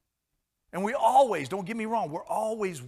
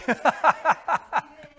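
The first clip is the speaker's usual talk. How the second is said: big high laugh; 10 semitones higher, 9 dB louder than usual. Real, not acted. Preacher laughing at the coffee habit, loud laugh.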